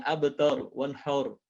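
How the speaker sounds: "abtar, wanhar" said as abtor, wanhor; "abtar" with tor for tar; common vowel mistake. Shown as a demonstration. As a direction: round the last A of "wanhar" to O. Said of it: "mempengaruhi huruf sebelumnya".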